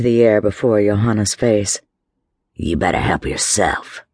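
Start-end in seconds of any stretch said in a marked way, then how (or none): 2.5-4.0 lightly voiced threat. A narrator's reading. Drunk shaking his fist at Johanna, low mild menace. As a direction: warn her darkly.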